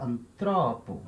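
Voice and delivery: antropo slow, TRO stretched and stressed; anTROpo, accent moved one syllable on; stress on second syllable. Shown as a demonstration. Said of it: "esse acento vai ter que andar".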